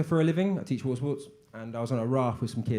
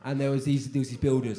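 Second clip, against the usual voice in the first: ordinary delivery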